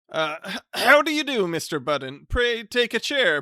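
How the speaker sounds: flustered, high, loud stammer; polite stammer. Acted. Flustered bachelor greeting his unwanted cousin with a stammer. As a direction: stumble over the greeting, flustered. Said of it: "politely stammered".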